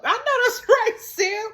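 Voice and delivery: high laugh breaking through the words; cracking up while agreeing with her friend; laughing agreement. Real, not acted.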